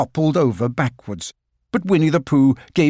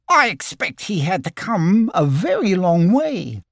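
Piglet voice; 6 semitones higher than usual. Acted.

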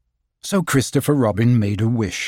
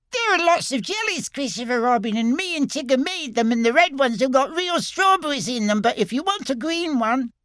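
little Roo voice; 13 semitones higher than usual. Acted.

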